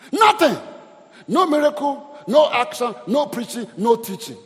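loud, high, fading shout; fiery preacher's climax, easing down; preaching shout. Real, not acted. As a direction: hammer each point home.

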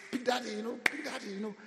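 put-on high, sing-song child voice calling Big Daddy; child's high call. Kids calling their pastor friend Big Daddy, high and eager. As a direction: call him like an eager little kid.

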